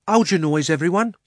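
high, loud cabby voice; loud, exasperated hushing; scolding cabby. Acted. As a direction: call for silence over a squabble. Cabby hushes the quarrel.

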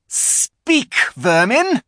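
high, loud contemptuous order; witch's command. A narrator's reading. Witch demanding the silent animals answer. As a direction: spit the insult down at them. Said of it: "she said again".

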